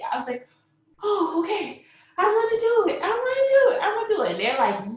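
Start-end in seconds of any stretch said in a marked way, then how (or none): 1.0-4.3 very high, rising in volume; frantic acted resolve. Mother agreeing to turn over and push, high frantic resolve. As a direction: re-enact eager panic, pitched way up.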